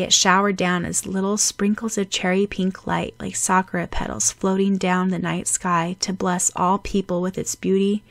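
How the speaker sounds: usual speaking voice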